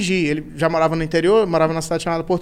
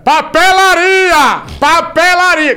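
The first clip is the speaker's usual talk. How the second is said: loud, much higher, slow yelled word; shouting from afar; 9 semitones higher, 7 dB louder, much slower than usual. Retold or imitated. Grandpa yelling to hide eating pastries, loud slow shout. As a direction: yell it from the back of the room. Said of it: "Aí ele gritava lá atrás".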